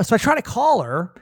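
usual speaking voice

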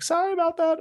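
put-on high, sweet old-lady call; lilting old neighbor voice calling her dog. Elderly neighbor calls her dog back, high and sweet. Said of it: "She's like".

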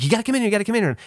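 high, insistent mock panic; reenacted frightened kid calling for help; urgent child's plea. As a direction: plead high, hurrying them along. Frightened child urging parents to come.

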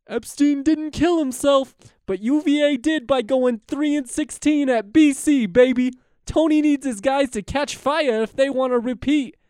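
high, very loud shouted sportscaster impression; Dickie V impersonation. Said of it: "Titus's tweet in a Dickie V voice".

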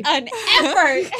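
high laughing shout. Real, not acted. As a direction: crack up while saying it. Laughing at own effort.

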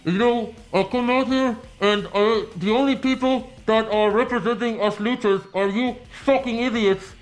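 high-pitched mock masked wrestler; Sin Cara impersonation. Host impersonating masked luchador Sin Cara, comic high voice. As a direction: speak up high as if through a mask. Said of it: "that's not a good impersonation".

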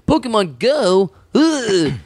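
lightly sending up a hack comic's disdain; hammed-up scoff at a fad; mock hacky comedian. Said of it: "hacky comedians are like".

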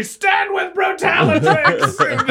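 slow, high shouted rally; blaring voice. Acted. Reginald lifting the healed Brutalatrix, blaring shout. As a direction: draw it out as a blaring call. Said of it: "his voice is just blaring".

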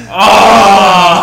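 loud, high "Oh!" of delight; excited shout. Retold or imitated. Fans hearing Randy Orton's music hit, shouting. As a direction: roar it like fans when the music hits. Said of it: "we both instantly like".

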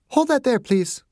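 no-nonsense bedside manner; doctor insisting on treating a patient; brisk Margaret. A narrator's reading. Margaret instructs Perry.

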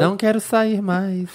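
slow, mocking imitation of the ex; mock breakup line. Friend mocking the ex's text, slowly and teasingly. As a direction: drawl it out, teasing. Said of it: "É só isso".

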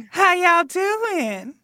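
high, slow Southern drawl; Southern accent. Pretend. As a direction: greet like a friendly Southern ghost. Answers as Southern ghost.

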